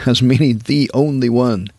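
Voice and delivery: ordinary talk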